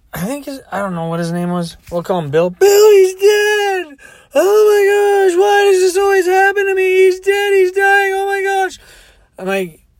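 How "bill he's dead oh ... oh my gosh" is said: hysterical crying. Retold or imitated. Drunk woman laments Bill to her driver, hammed-up wailing. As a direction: bawl it out like a melodramatic drunk. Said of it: "She's crying".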